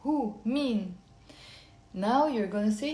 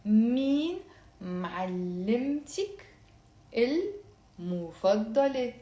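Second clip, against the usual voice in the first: slow, careful syllables. Shown as a demonstration. Teacher slowing down for students to repeat. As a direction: draw each word out for learners. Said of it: "very slowly and then faster".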